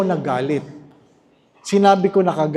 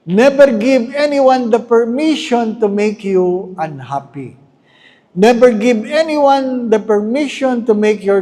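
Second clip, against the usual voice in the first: emphatic preaching; 5 semitones higher, 4 dB louder than usual. Real, not acted. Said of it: "preacher's emphatic exhortation".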